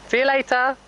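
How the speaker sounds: high, loud sing-song goodbye; cheery call. Real, not acted. Owner calling goodbye to the departing horse, bright high call.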